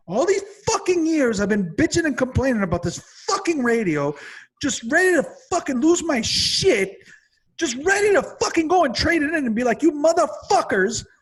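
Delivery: high, loud furious rant building to a yell; rising outrage, high and loud; angry rant. Real, not acted. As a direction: blow up at the dealers who cheated you. Ranting about dealer's missing antenna.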